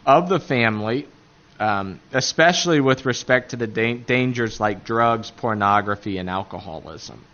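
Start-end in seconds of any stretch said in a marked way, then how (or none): none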